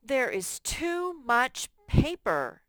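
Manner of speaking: a teacher proudly reading the fixed sentence as an exclamation. Shown as a demonstration. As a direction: shout "too" as if it is truly too much. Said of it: "Now let's read it again".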